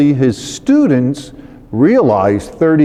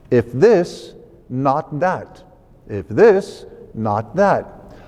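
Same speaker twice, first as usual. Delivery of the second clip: "not" dropped much softer, "this" rising; not unstressed; 3 dB quieter, much slower than usual. Shown as a demonstration. Lector shown how to read the repeated lines, no stress on not. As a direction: lift "this", let "not that" fall away quietly. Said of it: "Avoid the habit of emphasizing the word not".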